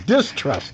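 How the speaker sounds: "Distrust" spoken steadily with a falling tune; helping pronounce. Shown as a demonstration. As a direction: say "Distrust" clearly to help him. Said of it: "I'll help you out there".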